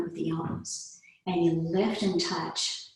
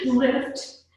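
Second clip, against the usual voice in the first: laughing; 8 semitones higher, 4 dB louder than usual. Real, not acted. Laughing still.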